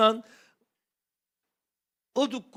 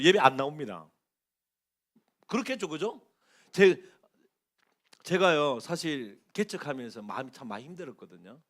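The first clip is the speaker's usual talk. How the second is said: ordinary delivery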